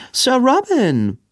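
a high, slow, drawn-out greeting; gushing society girl hailing him; Belinda calling out. Acted. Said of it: "rief Belinda".

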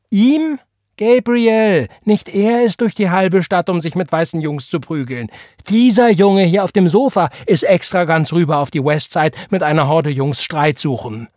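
high, loud indignant tirade; outraged shout. A narrator's reading. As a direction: shout the correction at her brother. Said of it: "schrie Tante Florence". (empört schreiend)

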